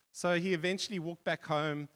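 normal talking voice

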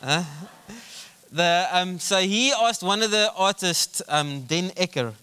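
usual speaking voice

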